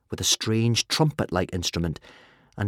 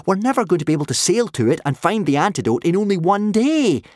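high, loud, mounting protest; arguing; 13 semitones higher, 6 dB louder than usual. A narrator's reading. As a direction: argue with rising exasperation. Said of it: "argued Hiccup".

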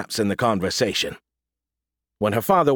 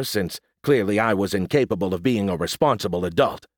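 ordinary delivery

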